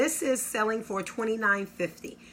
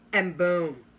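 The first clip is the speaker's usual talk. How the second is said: normal talking voice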